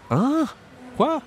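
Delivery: "Hein?" shot up very high with a sharp rising squeak; astonished hein. Shown as a demonstration. Teacher hamming up astonishment for students. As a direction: squeal "Hein?" in total shock. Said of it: "Quand on est étonné".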